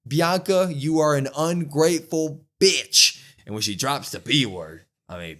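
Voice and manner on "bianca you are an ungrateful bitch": raised, louder mock tirade; hammed-up wrestling-promo fury; imitating an angry promo, pitched up; mock angry rant. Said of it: "and she starts pointing".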